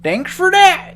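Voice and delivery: put-on high, loud old man.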